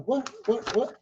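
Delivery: high-pitched baffled questions; confused. Retold or imitated. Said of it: "now I'm confused".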